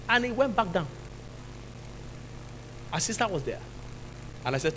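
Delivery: ordinary talk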